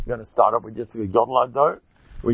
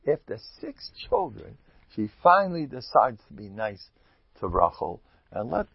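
normal talking voice

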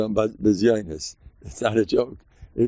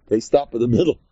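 ordinary talk

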